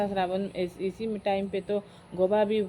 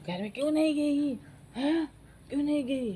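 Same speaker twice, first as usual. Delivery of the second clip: higher-pitched, lightly acted demand; mock scolding question; 6 semitones higher than usual. Grandfather questions grandmother in a retold quarrel, mock scolding. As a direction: scold in a raised pitch, as a retelling.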